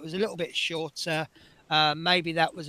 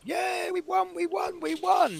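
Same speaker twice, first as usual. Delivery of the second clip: excited shout. Retold or imitated. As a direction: shout with joy as if winning. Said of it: "I went mental, I jumped up".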